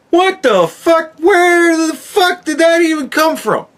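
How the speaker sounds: high, loud disbelief; baffled rant at the movie's invention; incredulous outburst. Real, not acted. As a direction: pitch up in baffled outrage. Ranting about a movie change.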